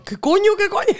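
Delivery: high startled blurt; panicked high what-the; startled cursing. Retold or imitated. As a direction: jump out of your skin mid-sentence. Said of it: "y yo".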